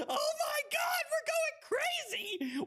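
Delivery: screaming with joy, high pitched; excited shout. Real, not acted. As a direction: yell it out in pure hype. Big win on stream.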